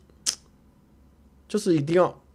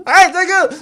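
much higher, loud eager hail; excited fan call; 8 dB louder, slower than usual. Retold or imitated. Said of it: "他就是".

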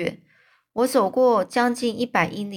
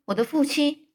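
higher-pitched, understated exclamation; mild surprised cry; 7 semitones higher than usual. A narrator's reading. Prisoner reacting to news of his father, mild surprised cry. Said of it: "我就大叫说".

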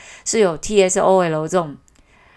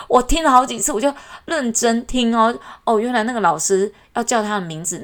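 ordinary delivery

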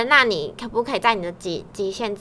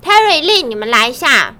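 boss summoning; 4 semitones higher, 9 dB louder than usual. Retold or imitated. Imitating boss summoning them.

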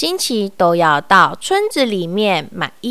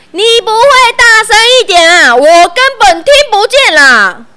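high, loud, fast impatient shout; impatient yell; 10 semitones higher, 8 dB louder than usual. A narrator's reading. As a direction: snap at him to speak up. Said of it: "有一点不耐烦的又吼了一次".

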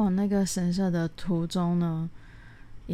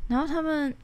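usual speaking voice

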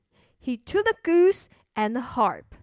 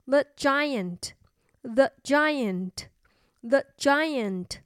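very slow, spaced-out "The Giant"; 慢慢地读; slow teaching; much slower than usual. Shown as a demonstration. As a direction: spell out "The Giant" slowly for kids. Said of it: "巨人的英文是".

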